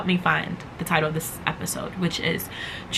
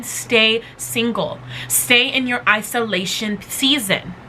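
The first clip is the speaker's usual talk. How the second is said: normal talking voice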